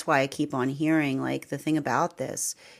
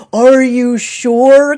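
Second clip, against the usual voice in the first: drawn-out, loud, skeptical "are you sure"; a loud, slow, teasing challenge; mock doubtful challenge; 7 semitones higher, 15 dB louder, much slower than usual. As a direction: slowly question their certainty, teasing. Mocking the universe's doubt.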